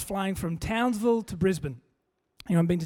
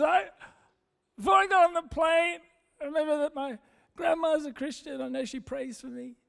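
high, mock-tearful voice; crying woman; 5 semitones higher than usual. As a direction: imitate her explaining through sobs. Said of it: "she's crying".

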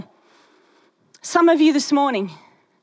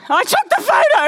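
jumps high and loud on the reveal; a triumphant high reveal; dropping the bomb; 12 semitones higher, 4 dB louder than usual. Retold or imitated. Ken reveals he's the photographer.

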